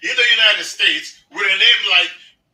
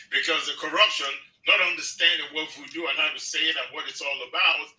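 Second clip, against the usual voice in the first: ordinary talk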